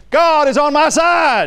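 high, slow, ringing proclamation; bold declaration. Acted. Preacher voicing righteousness speaking to the church, bold declaration. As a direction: declare it boldly, drawing the words out. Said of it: "and says boldly".

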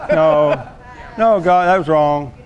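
ordinary talk

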